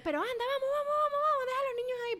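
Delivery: very high mock pestering; imitated insistent urging. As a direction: nag them along in a high, squeaky voice. Retold urging during the tantrum. (insistencia imitada, con voz muy aguda)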